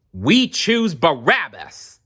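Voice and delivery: crowd yelling. Acted. Crowd answering Pilate, slow loud yell. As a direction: call it out as a hostile mob. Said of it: "the people yelled".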